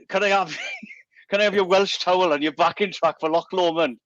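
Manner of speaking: cheeky mate quote. Retold or imitated. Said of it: "and he said".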